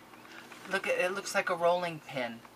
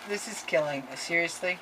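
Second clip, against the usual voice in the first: ordinary talk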